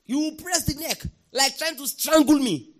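ordinary delivery

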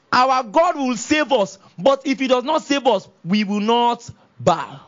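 emphatic pulpit declamation with weighty pauses; defiant preaching. Real, not acted. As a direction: declare a bold refusal before a king. Preaches the three boys' defiance.

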